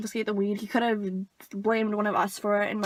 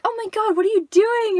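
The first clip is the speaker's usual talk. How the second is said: high, louder excited squeal of a question; excited girls; 10 semitones higher, 5 dB louder than usual. Retold or imitated. Other girls to the kids in the truck, high and excited. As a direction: mimic thrilled girls, pitched high. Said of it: "they were, like, all excited".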